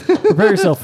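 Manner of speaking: can't keep a straight face reading it; giggling while rereading the email; laughing. Shown as a demonstration.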